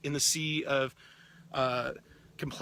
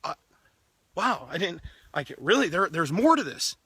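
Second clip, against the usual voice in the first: amazed realization; 2 semitones higher, 4 dB louder than usual. Pretend. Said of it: "they're like".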